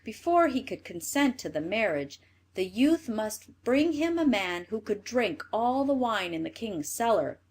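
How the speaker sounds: ordinary delivery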